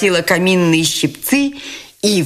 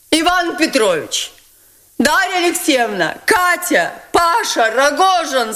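much higher, grand, commanding; Nastasya Filippovna, proud and imperious; 7 semitones higher than usual. Acted. Nastasya announcing the money is Ganya's, imperious. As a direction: rule the room like a queen.

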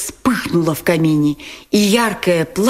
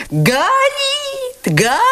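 crowd shouting. A narrator's reading. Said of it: "кричали все в один голос".

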